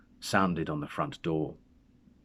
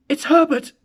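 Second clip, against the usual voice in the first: much higher, much louder frantic scream; 12 dB louder than usual. A narrator's reading. Mother screaming that her dead son is at the door. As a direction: shriek it out, desperate hope. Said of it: "she screamed".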